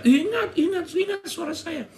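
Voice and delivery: lightly acted high-pitched eager reply; a touch of excited phone greeting; acted eager recognition. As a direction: answer an old friend's call, voice jumping up. Acted reply to old friend's call.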